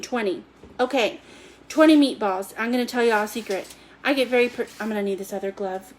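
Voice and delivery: ordinary talk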